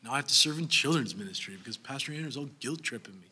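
low, grumbling reluctant mutter; begrudging whine. Pretend. Churchgoer grumbling about serving in children's ministry, low resentful mutter. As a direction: grumble like someone forced into volunteering. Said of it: "Not begrudgingly, like".

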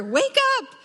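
high-pitched loud wake-up call. Acted. Imagining the Lord loudly rousing her, very loud. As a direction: holler it high to rouse someone. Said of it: "something very loud to me".

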